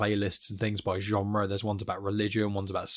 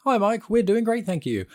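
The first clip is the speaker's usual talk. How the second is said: high, bright chorus of listeners answering; mock listeners' reply; 11 semitones higher, 4 dB louder than usual. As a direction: play the whole audience replying in a much higher voice. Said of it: "so I'll assume you're all going".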